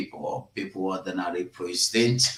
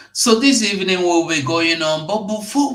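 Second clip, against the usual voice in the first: high, loud and fast announcement; emphatic opener; 9 semitones higher, 6 dB louder, faster than usual. Real, not acted. Host announcing the evening's program to listeners. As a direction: launch the program loudly.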